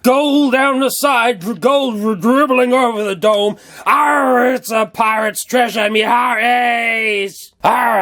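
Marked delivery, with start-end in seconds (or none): 3.8-8.0 slow, drawn-out pirate arr; hammed-up pirate proclaiming treasure; pirate cry. Vlogger showing gold loot, hammed-up pirate. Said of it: "I just stopped talking like a pirate".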